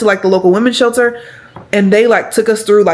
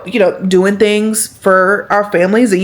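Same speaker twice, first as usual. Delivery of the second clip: ordinary talk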